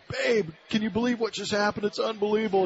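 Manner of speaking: bursting to share the big news; excited retelling. Retold or imitated. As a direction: voice jumps up high, eager and amazed. Excited husband after World Series homer.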